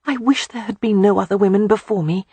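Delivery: blurted out. Acted. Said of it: "she couldn't help from blurting out".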